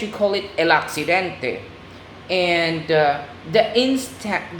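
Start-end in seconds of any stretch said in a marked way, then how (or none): 0.6-1.6 "accidente" in Spanish, ahk-see-DEN-teh; Spanish pronunciation. Shown as a demonstration. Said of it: "So in Spanish".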